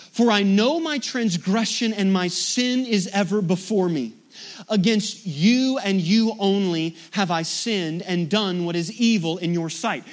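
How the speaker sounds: loud, weighty reading of the psalm; scripture read aloud. Acted. Reads scripture with force.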